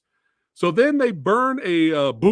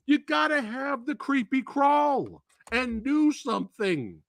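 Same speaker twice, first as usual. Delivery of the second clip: ordinary talk, nothing marked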